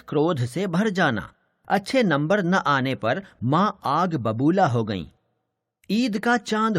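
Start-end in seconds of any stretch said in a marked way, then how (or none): none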